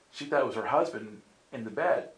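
ordinary delivery

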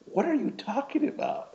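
high-pitched, incredulous question; baffled disbelief. Retold or imitated. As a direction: retell it in squeaky bafflement. Retelling bafflement at Justin's remark.

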